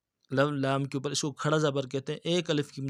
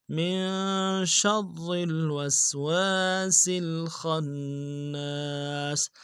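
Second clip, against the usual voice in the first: slow melodic tajweed recitation; Quran recitation; 3 semitones higher, much slower than usual. Shown as a demonstration. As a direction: chant it slowly with full tajweed. (قرآن کی تلاوت، آہستہ اور سریلی)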